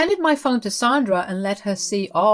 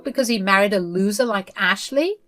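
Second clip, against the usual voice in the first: higher, sharp mother-in-law demanding answers; 5 semitones higher than usual. Acted. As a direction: play the meddling mother-in-law, higher pitched.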